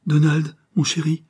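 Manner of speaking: sudden high exclamation; exclaiming. A narrator's reading. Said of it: "s'écria-t-elle".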